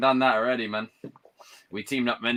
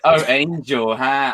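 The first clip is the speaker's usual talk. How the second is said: crowd teasing; 7 dB louder, much slower than usual. Retold or imitated. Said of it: "they're like".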